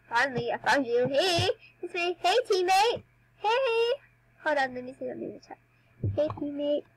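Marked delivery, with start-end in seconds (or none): 1.1-3.9 high, bright calls to a friend; calling out. Real, not acted. Girl greeting her game teammate, high calling voice. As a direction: call out to get her attention.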